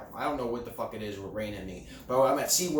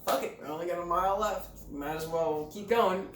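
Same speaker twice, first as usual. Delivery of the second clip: resigned shrug; 8 semitones higher than usual. Retold or imitated. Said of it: "So we're like".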